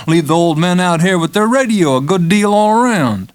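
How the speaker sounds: a patient coaxing the nurse, friendly and eager; McMurphy, wheedling. Acted. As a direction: sell the idea like a gambler making a deal. McMurphy's proposal.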